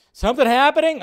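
sudden high, loud "something happening?"; startled by the chat, pitch jumps up; surprised. Real, not acted. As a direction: caught off guard mid-stream. Streamer spots sudden chat activity.

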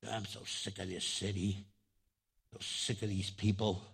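low, soft, weary grumble; acted jaded complaint under the breath; fed up. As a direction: mutter it low, worn out and sick of it all. Voicing a weary, broken person's thought.

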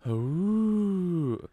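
high, drawn-out spooky "ooh"; mock ghostly moan. Quoting what supposedly said his name, high mock ghostly moan. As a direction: hoot it like a pretend ghost. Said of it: "he said the sentence".

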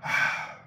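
short breathy sigh; demonstrated sigh. Shown as a demonstration. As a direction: let out a quick sigh as a tiny break. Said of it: "just enough time to go".

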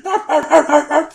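high, loud yapping snarls; rapid mock dog noises; imitated growling dog. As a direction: snap like a furious little dog. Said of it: "Fucking growling and biting at me".

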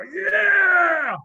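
high, loud triumphant yell, slowing on the middle line; hammed-up screaming from the cold survivor; retold scream. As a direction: bellow it out like a victory cry, voice straining. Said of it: "I scream and I go".

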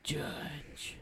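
a soft whispered repeat of the name; whispered echo. Real, not acted. Host repeating the actor's name in a whisper. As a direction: breathe the name back in a whisper. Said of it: "unvoiced echo".

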